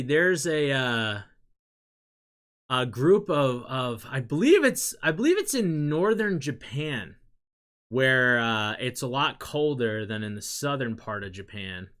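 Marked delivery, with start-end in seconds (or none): none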